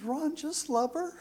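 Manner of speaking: soft, high reassuring reply; gentle wife. Retold or imitated. Wife reassuring her nervous husband, soft and high. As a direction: tell him softly it's simple. Wife answers anxious new father.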